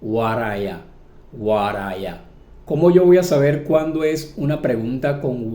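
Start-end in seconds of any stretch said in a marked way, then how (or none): 0.0-2.2 "What are ya?" said quickly as a single word; as one word. Shown as a demonstration. Teacher showing learners the linked form. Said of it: "la decimos como una sola palabra".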